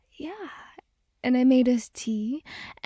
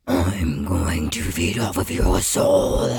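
demon voice; 7 dB louder than usual. Pretend. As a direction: growl it like a demon. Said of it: "my demon voice".

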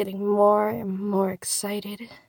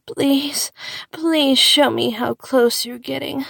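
louder, urgent plea; begging; 4 semitones higher, 6 dB louder than usual. Acted. Begging the listener to keep going, urgent plea. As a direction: beg with stretched, pressing pleases. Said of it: "I might even beg you to keep going".

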